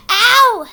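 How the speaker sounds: sharp high cry of pain; pained yelp. Acted. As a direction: yelp as the leg hurts. Eaglet hurt trying to stand.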